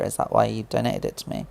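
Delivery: usual speaking voice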